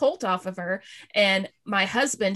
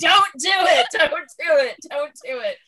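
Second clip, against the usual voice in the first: laughing plea; 7 semitones higher, 5 dB louder than usual. Real, not acted. Begging friend not to send videos.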